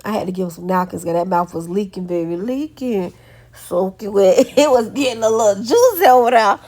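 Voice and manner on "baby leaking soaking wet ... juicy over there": laughing louder as she describes the mess; cracking up at the juicy mess; amused laughing rise. Real, not acted. Laughing at messy eating son.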